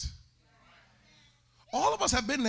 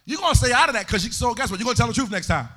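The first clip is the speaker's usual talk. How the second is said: loud, sing-song preaching cadence; full-force preaching; 3 semitones higher, 7 dB louder than usual. Acted.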